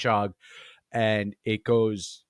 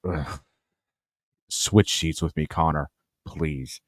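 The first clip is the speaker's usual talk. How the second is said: lower, slow mock-weary dad plea; 6 semitones lower, slower than usual. Dad begging his son to swap seats, low and slow. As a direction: sigh it out like a fed-up father. Serious dad voiced pleading aside.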